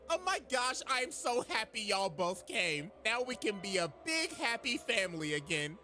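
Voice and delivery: high, gushing mock welcome; fake delight. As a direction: gush sweetly to lure them in. Azula's fake delight at their arrival.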